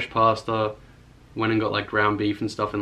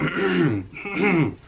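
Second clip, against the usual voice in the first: exaggerated mock throat clear; imitated throat clearing; 6 semitones higher than usual. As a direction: mimic her throat clearing. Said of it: "it sounds like she's like".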